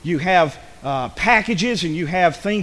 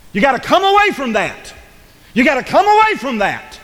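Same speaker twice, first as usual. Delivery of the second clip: raised, insistent pulpit refrain; full-force preaching; 7 semitones higher, 3 dB louder than usual. Acted. Preacher calling the congregation away, insistent preaching. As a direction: urge it insistently, voice pitched up.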